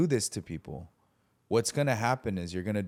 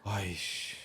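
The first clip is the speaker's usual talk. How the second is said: Korean exasperated sigh; 6 dB quieter than usual. Retold or imitated. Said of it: "he went like that".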